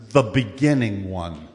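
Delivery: low, slow solemn answer; Jesus answering. Acted. Jesus answers the crowd, low and slow. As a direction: say it low and weighty. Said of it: "he answered them".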